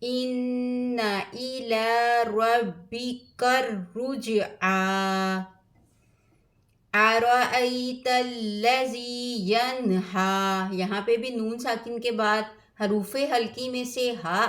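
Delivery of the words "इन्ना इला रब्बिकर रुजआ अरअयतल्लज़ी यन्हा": slow, drawn-out melodic recitation; Quran recitation. Acted. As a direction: chant each verse with tajweed. (धीमी क़ुरआन तिलावत)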